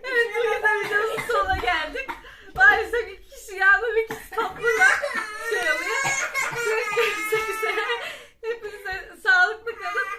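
loud laughing sign-off; laughing outro. Real, not acted. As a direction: wrap up through giggles.

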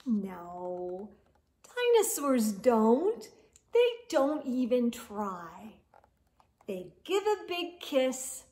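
lively storybook read-aloud; expressive picture-book narration; reading to children. Acted. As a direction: read it to kids at bedtime, animated.

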